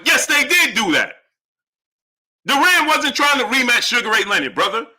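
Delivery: indignant contradiction. Real, not acted. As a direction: argue back hard, exasperated. Contradicting a viewer.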